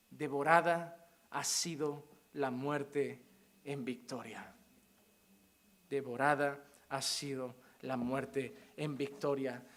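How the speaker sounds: low, slow solemn verse; solemn reading. Acted. Preacher reciting the promise, solemn and slow. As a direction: let it sink in slowly and low. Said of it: "solemn slow verse reading".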